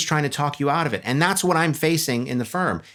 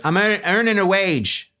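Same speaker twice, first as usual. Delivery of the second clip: higher, slow, put-on English accent; 7 semitones higher, 3 dB louder, slower than usual. Second stonemason answering what he is doing, mock English accent. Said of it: "Is that a good English accent?".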